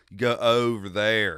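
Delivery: slow North Carolina drawl on the o's; North Carolina accent. Shown as a demonstration. Explaining local vowels to friend, slow North Carolina accent. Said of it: "It's the oohs and the ohs".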